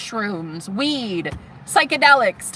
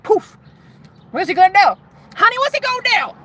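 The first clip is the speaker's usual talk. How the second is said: high incredulous taunt; 10 semitones higher, 4 dB louder, faster than usual. Real, not acted. Mocking the feared spell.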